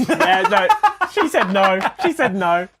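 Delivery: loud, high laughing relief; cracking up. Real, not acted. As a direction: shout it through helpless laughter. Laughing at Siri refusing.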